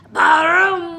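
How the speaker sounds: loud, slow thunder boom. A narrator's reading. Teacher reading the thunder to the class, loud and slow.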